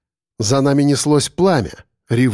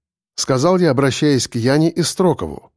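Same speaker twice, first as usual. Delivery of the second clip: plain reading voice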